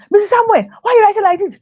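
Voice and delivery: hammed-up shrill complaint from the boss; boss complaining. Boss scolding the new teacher about her handwriting, high mock shout. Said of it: "I'm shouting".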